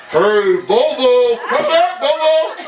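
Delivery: high, drawn-out calls through a car loudspeaker; calling over a loudspeaker. Retold or imitated. Deputy calling his lost dog over the car loudspeaker, high and stretched. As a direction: call the dog like you're on a PA. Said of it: "over that loudspeaker".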